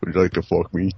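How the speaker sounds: creepy Buffalo Bill impression; lightly voiced creepy killer. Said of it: "what the hell was Bill, Buffalo Bill saying?".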